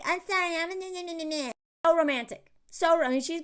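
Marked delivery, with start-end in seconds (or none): 0.0-1.5 softer, high fake sobbing; mock blubbering. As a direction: parody a man crying through an apology. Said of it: "And he's like".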